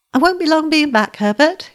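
high, lifted cheery call; cheerful. A narrator's reading. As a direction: toss it off brightly as you leave. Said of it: "she said, quite cheerfully".

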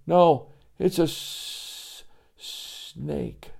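breathless stuttering whisper; too scared to say snake; stammering fright. Acted. Joey stammers again.

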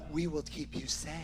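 very soft, almost under the breath; hushed mock promise. Speaker mimicking the KGB's promise to the congregation, hushed.

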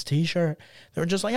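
ordinary delivery

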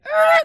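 very high, loud squeak; startled noise. Retold or imitated. Whole waiting room turns to stare, squeaked loudly. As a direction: imitate heads whipping round with a squeal. Everyone turning to look at him.

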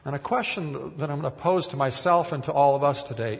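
normal talking voice

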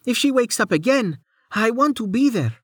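high, insistent character voice; calling over wind. A narrator's reading. Woman flying with Rain, wanting to be there, high call. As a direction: keep the voice high to carry while insisting.

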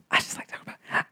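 breathy whispered aside; whispered to herself mid-story. Real, not acted. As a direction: drop to a private whisper.

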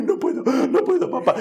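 put-on high, laughing childish whine; child refusing. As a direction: whine it like a giggling kid. La hija se niega. (niña quejándose entre risas)

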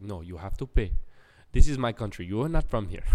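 lightly voiced Jamaican accent; stern old Jamaican man. Old bouncer claiming his country, in a light Jamaican accent. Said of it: "three old Jamaican guys".

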